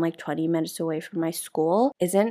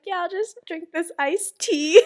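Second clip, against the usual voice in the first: laughing; 11 semitones higher, 5 dB louder than usual. Retold or imitated. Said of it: "too afraid to say anything".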